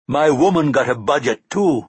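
playful Jamaican cabby comeback; Jamaican accent. Acted. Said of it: "in a powerful Jamaican accent".

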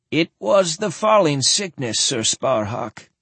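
put-on an old lord mourning his king; sad old man. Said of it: "he replied sadly".

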